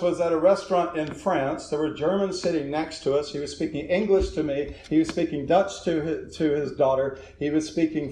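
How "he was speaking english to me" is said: normal talking voice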